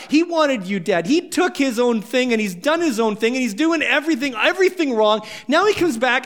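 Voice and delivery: jealous brother raging at his father; angry older son. Acted. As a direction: hurl every grievance at dad. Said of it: "the son's going".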